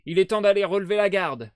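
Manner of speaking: ordre lancé fort, un cran plus aigu; appel rude. Retold or imitated. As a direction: imiter l'homme qui appelle à la fenêtre. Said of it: "roque et voilée". (homme qui crie à travers la fenêtre, ton rude)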